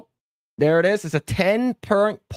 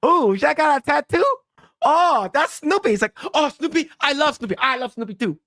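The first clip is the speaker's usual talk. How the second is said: much higher excited mock voice; Chris Tucker impression; 9 semitones higher than usual. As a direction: gush high like Chris Tucker. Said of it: "it's like".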